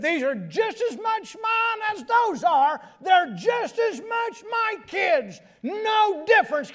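shouted high, preaching at full force; emphatic quoted declaration. Retold or imitated. Preacher shouting the adoptive father's words to the congregation. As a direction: proclaim it with all your might.

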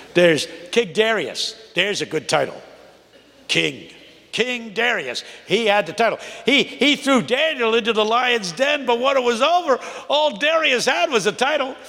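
high, rising preacher's cadence building to a cry; full-force preaching. Acted. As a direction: preach it like a Baptist pulpit. Preaching the sermon retold.

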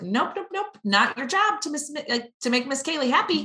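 high, sing-song mom voice correcting; gentle correction to toddler. Retold or imitated. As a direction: pitch it way up like talking to a toddler.

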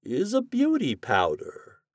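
high, slow, drawn-out musing; pondering theatrical reveal; thoughtful wizard. A narrator's reading. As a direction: let the words out slowly in a high wizard voice. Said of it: "replied the wizard thoughtfully".

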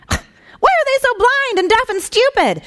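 high, cross little angel voice. A narrator's reading. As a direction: ask it crossly, fed up. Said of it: "asked the junior angel rather crossly".

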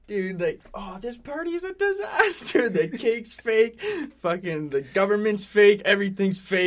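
high, loud, hammed-up despairing rant; exaggerated wail of party disaster; mock meltdown. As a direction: play an upset mom losing it over everything. Acts out mom ranting at party.

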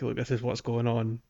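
ordinary talk, nothing marked

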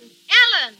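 loud call up to the window; shouting back. Acted. Kitty shouts back up at Alan in the window. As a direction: yell his name back up. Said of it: "just as bad to shout back".

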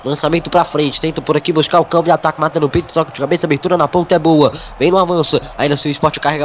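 ordinary delivery

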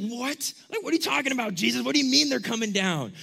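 high-pitched, incredulous questioning; shocked disbelief. Acted. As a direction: demand an explanation in acted alarm. Acted shocked reaction to bad news.

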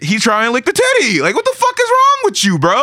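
high, incredulous outburst; outraged disbelief. Real, not acted. Fan reacting to the king's act, high and outraged. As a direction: rise up in shock at the king.

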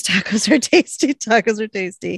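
quoting an urgent signal; retold signal. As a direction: call out the signal, then repeat it. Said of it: "sends up the signal, says".

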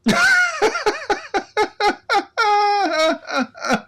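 long shrieking laugh near tears; big high laugh. Real, not acted. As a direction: crack up helplessly at your own joke. Cracks up at own joke.

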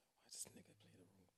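soft unvoiced vexed aside; whispered. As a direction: hiss it under breath. Voicing vexed [name].

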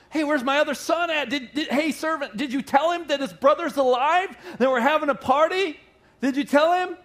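high, loud, laughing father's questions; eager father. Retold or imitated. Father asking the servant about his son, excited and laughing. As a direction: bubble over, asking after the missing son.

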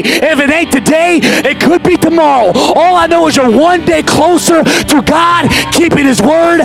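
rally the crowd, preaching hard; fiery preaching. Acted. Preaching at full force.

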